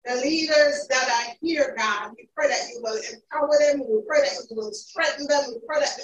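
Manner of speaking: ordinary talk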